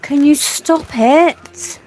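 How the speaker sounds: high, cooing pet talk; dog talk voice. Real, not acted.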